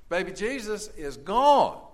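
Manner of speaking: ordinary talk, nothing marked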